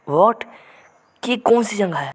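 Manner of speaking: high-pitched mock bewilderment; retold shock at a strange place; astonished. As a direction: exclaim as the startled hero. Startled hero sees unknown place.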